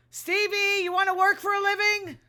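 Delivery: loud, high call to the cat. Real, not acted. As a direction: call across the room to Stevie. Calling out to her cat.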